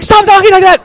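put-on high, loud angry little boy shout; son yells. Son yelling at his sister from the bunk bed, high and loud. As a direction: yell it like an offended kid. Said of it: "he yells".

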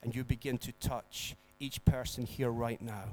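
soft, low, reverent prayer voice. Acted. Pastor praying softly for the congregation, reverent. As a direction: pray quietly over the congregation.

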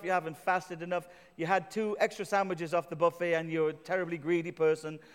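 ordinary delivery

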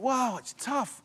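a lightly voiced daunted listener; imitating a doubter. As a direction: voice the listener who finds it hard. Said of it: "You think".

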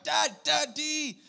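high-pitched babbled call; mock baby calling from the cot; shouting toddler. Toddler in his cot calling dad, high babble. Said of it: "He's just sitting on his cot".

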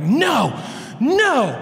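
hammed-up childish wail like his daughter; mock tearful protest. Pastor mimicking his daughter's teary refusal about the semester ending, mock whine.

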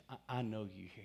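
hushed, soft prayer; whispering to God. Retold or imitated. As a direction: quietly confide it to the Father. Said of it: "whisper that to God".

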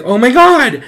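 high, loud cry of alarm; re-enacted terrified yell; panicked scream. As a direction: shriek it in retold panic. Acts terror at being thrown.

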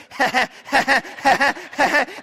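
put-on gleeful child laugh. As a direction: giggle as if getting away. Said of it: "she's like".

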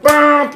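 loud high mock car horn blast; a sudden loud beep in imitation; imitated car hooter. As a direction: honk it out like an angry driver. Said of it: "Like, really loud".

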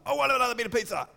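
put-on pushy greedy kid voice; demanding child. Shown as a demonstration. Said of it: "the difference in my face too".

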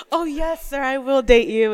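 mock swooning. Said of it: "He's so offended".

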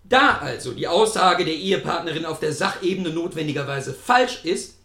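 measured reading of a novel; story narration. Acted. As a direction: read the passage deliberately. Reads his own novel aloud.

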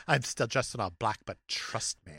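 low, soft sassy fashion diva; diva queen. Acted. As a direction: confide it low, like a mock-haughty diva.

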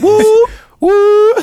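hype woo. Real, not acted. Shout out to absent friend.

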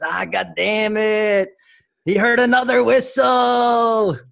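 slow, high, loud hammed-up Italian uncle yelling; uncle's Italian-American accent. The uncle blaming another whistle, hammed-up accent yelling. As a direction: bellow it like an outraged old Italian butcher. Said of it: "try and do it in his accent".

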